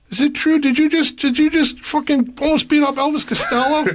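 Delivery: high, eager, gossipy question; thrilled to ask about the rumour; excited musician. Retold or imitated. Saw Doctors guy asking about the fight, excited. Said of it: "comes up to me and goes".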